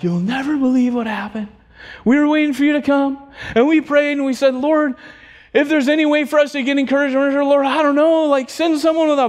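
high, mildly breathless-with-news account; excited retelling. Retold or imitated. Woman tells pastor what happened after praying, retold excitement. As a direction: tumble through the news as an eager witness.

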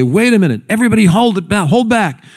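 high, urgent crowd voice; quick, high-pitched mock commotion; acted-out alarm. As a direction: call a halt like an excited onlooker. Acts out excited bystanders halting everyone.

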